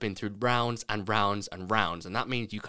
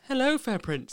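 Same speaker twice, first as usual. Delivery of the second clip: high, sweet princess voice; 13 semitones higher than usual. Retold or imitated. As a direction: greet like a fairytale princess. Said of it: "she's like".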